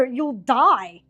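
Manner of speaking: normal talking voice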